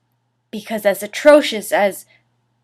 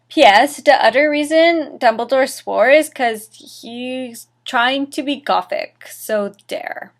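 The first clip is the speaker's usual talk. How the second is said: dramatic reading; 4 semitones higher, 4 dB louder than usual. Acted. Reads fanfic aloud dramatically.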